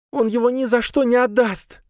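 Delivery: high, despairing sigh. A narrator's reading. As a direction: sigh it hopelessly. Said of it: "вздохнула она".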